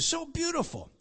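high, delighted so beautiful; high admiring. Real, not acted.

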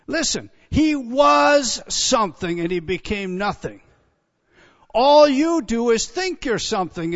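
fervent shouted preaching. Real, not acted. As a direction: drive it home to the congregation. Preacher driving point home.

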